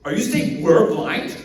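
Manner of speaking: high, loud affronted disbelief; incredulous. Acted. Pharisees hearing they are spiritually blind, incredulous. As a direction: question it as if you cannot believe your ears. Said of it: "They're just incredulous".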